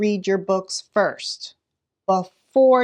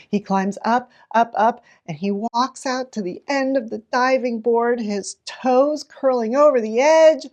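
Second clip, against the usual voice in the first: climbing higher and louder with tension; rising suspense; 6 semitones higher, 3 dB louder than usual. Shown as a demonstration. Teacher reading the diving board climb, rising suspense. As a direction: build the tension step by step. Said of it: "build anticipation".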